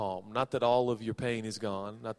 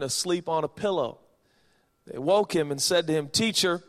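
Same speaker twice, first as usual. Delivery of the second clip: raised, emphatic pulpit reading; scripture reading; 5 semitones higher, 6 dB louder than usual. Acted. Preacher reading scripture to the congregation, emphatic. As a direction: declaim the Bible passage with force.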